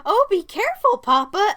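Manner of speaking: airy cheerful mom warning; cheerful mother. Retold or imitated. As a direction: chirp it sweetly and carelessly. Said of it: "as cheerfully from the pilot seat".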